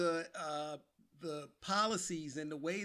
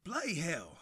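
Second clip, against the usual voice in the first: attempted British accent. Shown as a demonstration. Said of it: "let me see if I can do this here".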